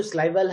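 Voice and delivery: "syllable" said as SLY-val; students' mispronunciation. Retold or imitated. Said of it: "has pronounced this syllable". ("syllable" का गलत उच्चारण, स्लाइवल)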